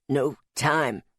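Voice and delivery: low, laboured words; dying strain. A narrator's reading. Dying Louis refusing help, low laboured strain. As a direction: squeeze out last words in a deep voice.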